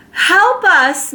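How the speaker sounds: high, loud call; calling for help. Acted. Mrs Watson calling from the hole, high loud cry. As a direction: cry out for rescue. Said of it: "Missus Watson called".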